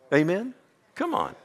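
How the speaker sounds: ordinary talk, nothing marked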